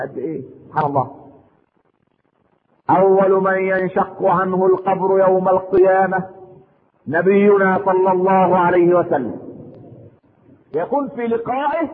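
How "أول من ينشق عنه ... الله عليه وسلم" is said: loud, slow pulpit proclamation; booming sermon delivery, every word drawn out; full-force preaching. Acted. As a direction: proclaim it slowly to the whole mosque. Preacher proclaims the Prophet's rising.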